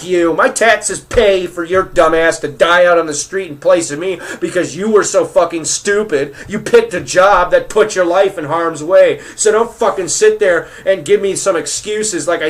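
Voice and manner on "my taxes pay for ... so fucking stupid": ordinary talk, nothing marked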